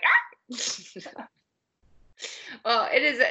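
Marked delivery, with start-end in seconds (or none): 0.0-1.3 short mock yelp of fright; quick startled cry. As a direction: jump at a bird hitting the glass. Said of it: "every time you'd be like".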